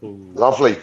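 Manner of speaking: ordinary talk, nothing marked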